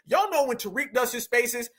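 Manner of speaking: ordinary talk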